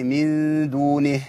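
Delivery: "من دونه" slow, closed on a still H with no ee; stopping on H. Shown as a demonstration. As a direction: drop the final vowel of "دونه" at the pause. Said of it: "خلاص عندي تسقط الإيه؟". ("من دونه" وقف بهاء ساكنة)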